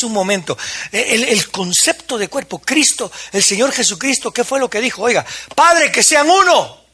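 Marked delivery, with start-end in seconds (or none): 5.0-6.8 raised, higher cry to the Father; fervent plea. Acted. Jesus crying out to the Father, a loud, high plea. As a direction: call out to the Father with urgency. Preacher voices Jesus praying.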